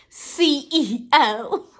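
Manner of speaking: C E O stretched out letter by letter; slow drawn boast. Pretend. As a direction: savor each letter like a brag.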